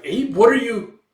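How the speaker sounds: high, loud shocked shout; astonished cry. Acted. Seeing Dragonfly sprout wings, loud high astonished cry. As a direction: cry out in disbelief.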